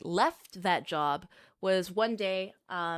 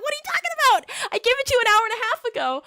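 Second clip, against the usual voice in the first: much higher, louder, fast disbelieving yell; shouting at the cook over the lost order, incredulous and loud; 7 dB louder, faster than usual. Retold or imitated.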